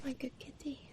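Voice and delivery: tender whisper. Real, not acted. Whispered praise to the cat.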